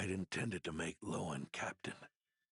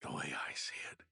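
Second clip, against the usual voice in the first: fading whisper; 5 semitones lower than usual. Acted. The dying knight reassures the young medic, fading whisper. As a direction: let the words barely escape.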